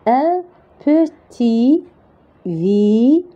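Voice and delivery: "petit" slowly, ending on the i with no T; silent T. Shown as a demonstration. Teacher modelling "un petit village" slowly, silent T. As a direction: stretch "petit" and leave the final T silent. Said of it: "on va pas la prononcer".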